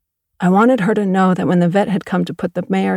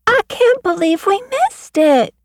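much higher, slow whiny wail; wailing girl; 4 dB louder than usual. Acted. Girl wailing to the farmer about missing the foal, high and slow. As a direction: wail it like a disappointed kid. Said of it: "Sheila wailed".

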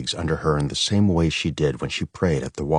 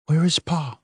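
put-on anxious child; 12 semitones higher than usual. A narrator's reading.

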